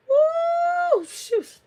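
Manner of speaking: very high, loud whoop; excited whoop. Real, not acted. Amazed at a viewer's fifty bags of herbs, whooping. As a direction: let out an amazed, drawn-out woo.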